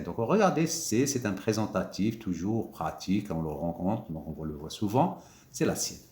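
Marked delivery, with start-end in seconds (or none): none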